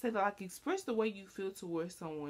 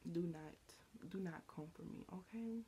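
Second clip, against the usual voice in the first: quiet warning; 13 dB quieter than usual. Real, not acted. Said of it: "warning the checker".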